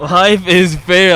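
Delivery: slow Russian accent. Shown as a demonstration. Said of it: "but in a Russian accent".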